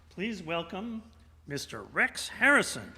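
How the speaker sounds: ordinary talk, nothing marked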